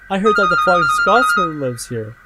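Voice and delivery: thrilled. Acted. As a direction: share exciting news loudly. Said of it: "said with much thrill".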